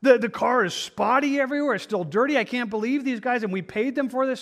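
a retold rant, high-pitched and indignant at the sloppy car wash; furious husband. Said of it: "the husband was just furious".

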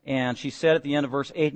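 normal talking voice

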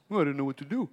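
mocking his own false excuses. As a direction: play dumb in a mock innocent voice. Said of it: "I've not been confused".

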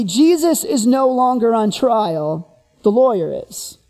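normal talking voice

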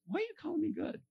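squeaky, puzzled question; small high-pitched comic query. Acted. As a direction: ask it way up high, mock-bewildered. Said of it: "And Jesus is like".

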